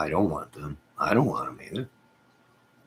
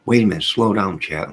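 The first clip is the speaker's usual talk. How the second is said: louder, urgent wait-a-minute; excited outburst; 2 semitones higher, 8 dB louder than usual. Real, not acted. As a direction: call out to stop the scrolling chat. Chat scrolling too fast.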